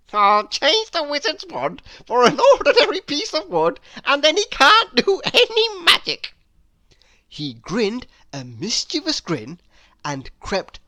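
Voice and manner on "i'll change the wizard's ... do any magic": high, loud giggling voice. A narrator's reading. Mister Mischief plotting a prank, giggling high. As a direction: giggle through the words like a naughty imp. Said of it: "giggled Mister Mischief".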